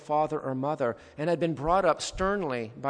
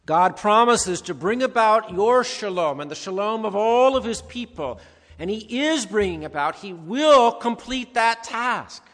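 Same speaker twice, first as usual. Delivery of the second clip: louder, rising preacher's build; preaching; 6 semitones higher, 9 dB louder than usual. Acted. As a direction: urge the congregation to cry out.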